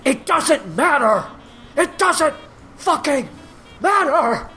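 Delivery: high-pitched strained yell, word by word; imitating a man screaming in a fight; mock scream. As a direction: scream it out slowly as a retold rant. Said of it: "was screaming".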